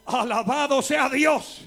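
fast, driving repeated refrain; rhythmic preaching. Acted. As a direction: preach the list with growing drive. Preaching build-up, repeated phrase.

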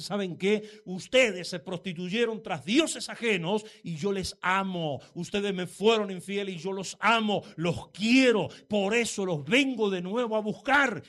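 loud, fervent preaching at full voice; full-force preaching. Acted. Preacher telling the Hosea story to church, fervent loud preaching. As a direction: pour it out over the congregation.

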